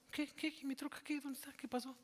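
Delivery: high, breathless shocked questions; stunned disbelief. Retold or imitated. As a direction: relive the moment the truck is gone, pitch up. Retold finding truck stolen.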